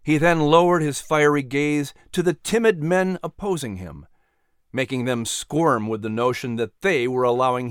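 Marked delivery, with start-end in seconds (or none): none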